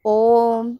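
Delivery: ओम with the vowel held very long; prolonged vowel. Shown as a demonstration. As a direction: draw out ओम like a chant. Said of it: "प्लुत स्वर का ही एक उदाहरण है". (ओम को बहुत लंबा खींचकर)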